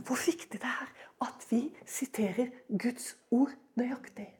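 hushed urgent aside; intense whisper. Acted. Preacher whispering to the congregation about exact words. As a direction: lean in and whisper it.

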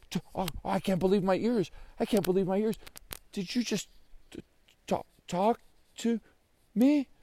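high-pitched, halting disbelief; incredulous. Acted. Joey asks if the bird talked, incredulous. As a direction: stammer the question, rising at the end. Boy doubts his ears.

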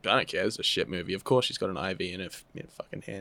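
fast, throwaway mock indifference; dismissive. Acted dismissive viewer reaction.

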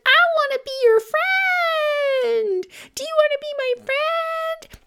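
put-on very high, far-off-natural baby creature voice; Unico. Baby unicorn asking the bully cat for friendship, high-pitched and imploring. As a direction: squeak it out as an eager little unicorn. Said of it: "Unico is just like".

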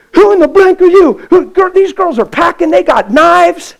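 high, loud, alarmed shout; startled yell. Retold or imitated. Street girl shouting at the pastor gripping her wrist. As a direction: yell a warning at the stranger.

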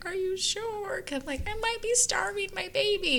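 high, anxious retold worry; panicked new mom. New mom doubts the friend, fearing she starves baby, high and anxious. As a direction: pitch up into a fretful plea. Retelling her own panic.

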